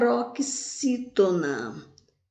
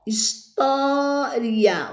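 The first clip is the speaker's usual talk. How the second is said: "história" with TÓ drawn out long and higher; stress on TÓ; 4 semitones higher, much slower than usual. Shown as a demonstration. Teacher showing where the strong syllable falls, "história" with a long stressed TÓ. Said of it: "é a sílaba mais forte".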